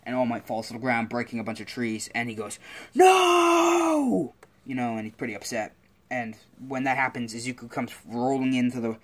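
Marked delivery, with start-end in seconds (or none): 3.0-4.3 long, high, loud cry of defeat; anguished shout. Acted. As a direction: bellow it in drawn-out frustration. Hero cries out after villain escapes.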